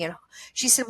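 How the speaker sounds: ordinary talk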